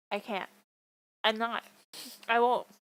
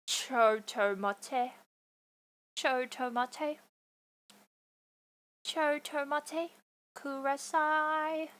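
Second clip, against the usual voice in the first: very slow, stretched Japanese phrase; exaggerated slow-motion pronunciation; drawn-out syllables; 3 semitones higher, much slower than usual. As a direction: drag out every syllable with a rising end. Drawn-out mock Japanese after normal take.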